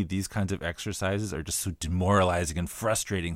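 normal talking voice

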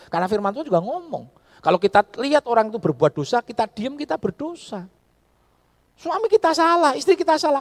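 high, loud, impassioned sermon shouting; fiery preaching. Acted. Pastor urging couples to speak up, shouting from the pulpit. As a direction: drive the rebuke home to the congregation.

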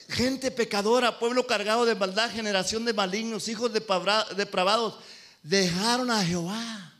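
loud, slowed scripture rebuke; full-force preaching. Acted. Preacher thundering God's rebuke at the congregation. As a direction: denounce the sinners from the pulpit.

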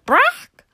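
a sudden sharp chicken squawk, high and loud; high loud hen squawk. A narrator's reading. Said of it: "There was a loud".

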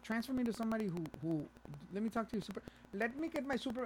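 high, agitated retelling; mock demand. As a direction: demand a supervisor, hammed up. Acted quarrel with scam caller.

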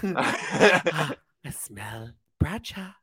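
slow, whispered witch impression; mock witch whisper. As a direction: sniff out prey in a creepy whisper. Playing a child-hunting movie witch.

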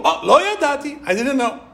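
high, singsong feigned innocence; mock Bilam's lame excuse; Bilam's slogan, whined high; mock innocent plea.

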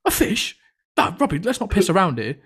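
a high, disbelieving yelp on fish; incredulous teacher. Retold or imitated. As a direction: play the teacher who can't believe what she's hearing. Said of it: "she went".

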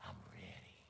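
breathy near-silent I'm ready; whispered. Retold or imitated. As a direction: hush it like before a showdown. Tense whispered reply.

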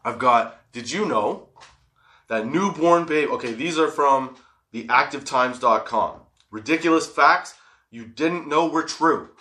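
ordinary talk

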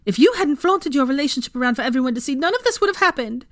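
fast, high, loud accusation; spat. A narrator's reading. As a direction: rattle out the blame furiously. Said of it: "she spat".

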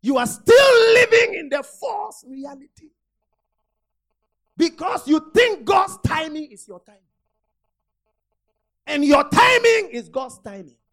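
loud shouting sermon; full-force preaching. Real, not acted. Preacher shouts at the congregation. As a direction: drive it home, crying out.

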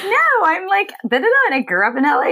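high, fast, bubbly Valley girl chatter, hammed up. Retelling her reply to the British Vogue writer, as a high, fast Valley girl. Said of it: "you sound like a Valley girl".

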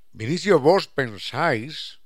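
hammed-up old Castilian courtly address; Quijote-style speech. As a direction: play Don Quijote addressing Vinicio. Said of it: "como si fuera el Quijote".

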